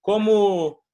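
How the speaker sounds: an exasperated foreigner's cry of confusion; confused learner. Retold or imitated. Pastor Simon asks his translator, loud and baffled. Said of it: "ele perguntava assim".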